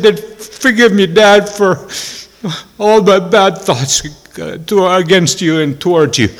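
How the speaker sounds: high-pitched, heartfelt plea; emotional apology. Retold or imitated. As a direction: son pouring out an apology to his father. Retold tearful reconciliation with father.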